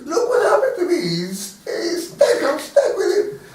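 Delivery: high, loud mock wail; retold crying shout. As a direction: act out a panicked cry for help. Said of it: "he starts crying and shouting".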